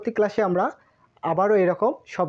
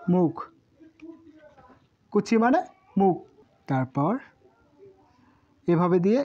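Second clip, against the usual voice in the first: usual speaking voice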